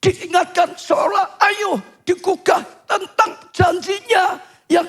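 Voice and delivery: high-pitched, earnest sermon delivery; impassioned preaching. Acted. Preacher explains cries reminding God of his promise, impassioned preaching. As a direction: insist on it in emphatic phrases.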